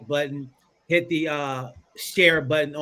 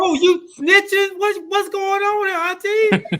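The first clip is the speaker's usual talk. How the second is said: much higher, louder, slow teasing cry; loud falsetto accusing a snitching aunt; mock outrage; 6 dB louder than usual. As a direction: ham up the shock.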